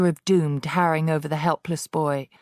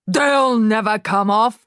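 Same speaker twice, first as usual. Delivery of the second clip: louder, slow booming retort; shouted back; 5 semitones higher, 7 dB louder than usual. A narrator's reading. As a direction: boom it across the room. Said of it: "the Trunchbull shouted back".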